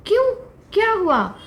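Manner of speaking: high, loud sudden question; mother shocked at her fawn's news; startled mother deer. Acted. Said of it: "mummy तो चौंक गई". (चौंकी हुई माँ)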